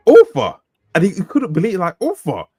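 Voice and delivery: lightly imitated disbelieving old man; old Scottish cabbie. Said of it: "this old Scottish guy was like".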